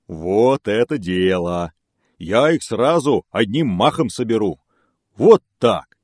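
loud, slow, boastful bear. Acted. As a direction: brag like a big bear.

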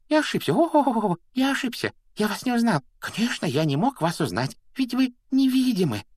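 very high, squeaky apologising; frantic servile wheedling, high-pitched; obsequious grovelling. A narrator's reading. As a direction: beg forgiveness in a shrill imp voice. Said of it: "подобострастно заюлил он".

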